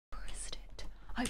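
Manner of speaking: whispering. Real, not acted. Panicked whisper after pressing button.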